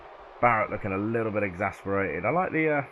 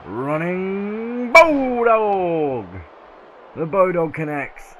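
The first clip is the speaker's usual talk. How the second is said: long, stretched-out announcer call, much higher and louder; hyped move call; 9 semitones higher, 8 dB louder, much slower than usual. Real, not acted. Commentator hyping the finisher for viewers, stretched-out call. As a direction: draw out the move name like a ring announcer.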